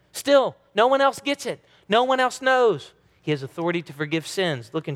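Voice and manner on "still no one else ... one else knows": higher, emphatic insistence; emphatic declaration. Acted. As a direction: press home that no one knows.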